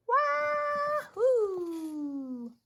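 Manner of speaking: high, long excited wahoo; joyful whoop. Acted. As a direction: whoop with delight. Duck's joyful thought.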